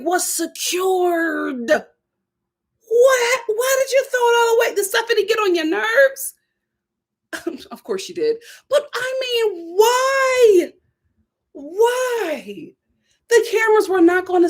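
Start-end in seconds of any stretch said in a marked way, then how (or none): 1.7-12.7 higher, cracking shout of disbelief; scolding Harris, voice cracking in disbelief; outraged disbelief. Real, not acted. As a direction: wail why at him. Outrage at fumbling the bag.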